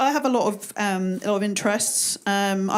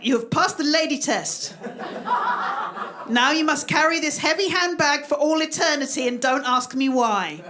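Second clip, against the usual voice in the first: lightly acted doctor, raised pitch; higher, declaiming doctor voice; 4 semitones higher than usual. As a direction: make a mock-solemn pronouncement as a doctor. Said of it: "the doctor going".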